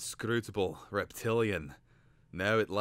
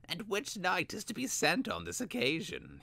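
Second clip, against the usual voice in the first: much higher, probing voice; sly young scholar; 13 semitones higher than usual. Acted. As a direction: probe with feigned calm. Vilnix voiced in story.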